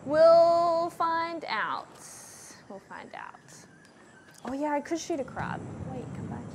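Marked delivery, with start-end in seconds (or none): 0.1-1.9 very slow, higher, stretched words; drawn-out singsong. Pretend. Drawn-out mock-ominous repeat of own phrase. 2.7-3.3 whispered aside. Pretend. Same words again, under breath.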